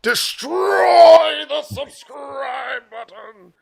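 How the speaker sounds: high, loud mock yell, stretched out; hammed-up shout. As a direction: bellow it like a hype outro. Outro call to action, hammed.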